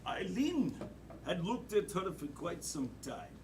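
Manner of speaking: hushed, low horror storyteller; horror movie narration. Acted. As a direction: creep through it quietly, pausing for suspense. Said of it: "in the style of a horror movie".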